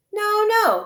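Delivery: high, loud breezy no no; airy doctor waving off the question; dismissive. Acted. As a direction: brush the worry aside. Doctor dismisses snake fear.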